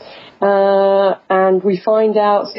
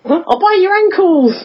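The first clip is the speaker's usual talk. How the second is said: high, shouty Monty Python knight; 10 semitones higher than usual. Retold or imitated. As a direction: yell it like the armless knight.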